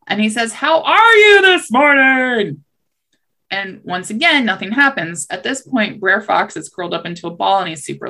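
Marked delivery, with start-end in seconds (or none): 0.5-2.6 high, slow, booming shout; shouting to the deaf. Acted. Rabbit greets a seemingly deaf tar baby, loud slow shout. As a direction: stretch each word out loudly for deaf ears. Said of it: "thinking that tar baby is deaf".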